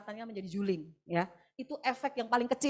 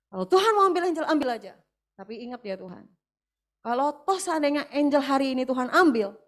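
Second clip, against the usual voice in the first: louder, insistent promise to God; fervent vow; 3 semitones higher, 7 dB louder than usual. Retold or imitated. Mother vows to God to keep serving, loud and fervent. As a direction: declare it like an unbreakable oath.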